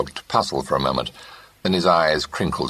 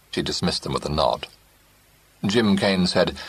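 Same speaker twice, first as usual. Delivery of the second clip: usual speaking voice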